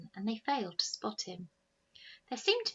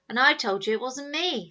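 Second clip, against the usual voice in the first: retort; 5 semitones higher, 10 dB louder than usual. Acted. Youngest retorting.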